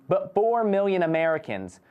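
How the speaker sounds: ordinary talk, nothing marked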